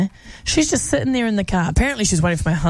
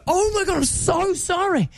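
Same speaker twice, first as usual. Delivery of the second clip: higher, fast gushing sorry; high panicked apology; flustered apology; 9 semitones higher than usual. Retold or imitated. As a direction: babble an embarrassed apology. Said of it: "And I just go".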